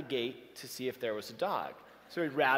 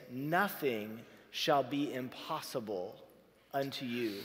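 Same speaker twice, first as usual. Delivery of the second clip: slow scripture quote; 4 semitones lower, slower than usual. Shown as a demonstration. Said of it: "there's this little phrase".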